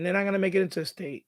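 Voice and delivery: ordinary delivery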